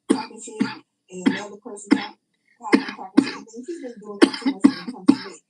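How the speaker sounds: loud, rising angry complaint; loud angry rant about him; heated rant. Real, not acted. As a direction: push it out loud and hot.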